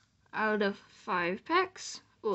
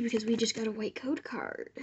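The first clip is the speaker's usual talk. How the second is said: whispering; 2 semitones lower, 7 dB quieter than usual. Real, not acted. Whispering about the rare card.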